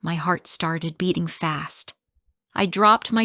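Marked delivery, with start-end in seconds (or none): none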